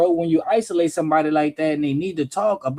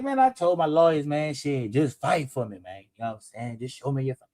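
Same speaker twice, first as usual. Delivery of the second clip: low, much softer mock plea; quiet low imitation of a defeated defendant; 7 semitones lower, 6 dB quieter than usual. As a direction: mumble it low like a beaten man. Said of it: "Ain't nobody gonna sit back and be like".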